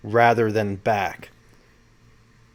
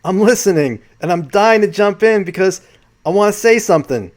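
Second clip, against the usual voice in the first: loud, raised-pitch New York eagerness; New York accent; 8 semitones higher, 6 dB louder than usual. Retold or imitated. Retelling Ralph dying to jump in, loud New York accent. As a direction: imitate an eager New Yorker jumping in. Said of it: "That's my New York accent".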